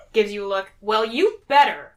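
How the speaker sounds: usual speaking voice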